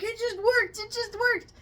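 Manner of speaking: high, loud exasperated cry; mock frustrated protest. Imitating the husband when the lights die, mock frustration. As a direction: act out a man beside himself, voice shooting up. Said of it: "he's all pissed off".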